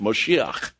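"Moshiach" with a throaty final ch; Hebrew pronunciation. Shown as a demonstration. Preacher showing the Hebrew word for anointed, "Moshiach" pronounced as in Hebrew. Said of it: "in Hebrew, you would pronounce it".

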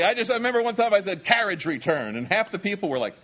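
high, loud and tickled, on the edge of laughter; cracking up while recalling a story; amused, laughing. Real, not acted. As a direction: talk through your own laughter. Cracking up at his dated reference.

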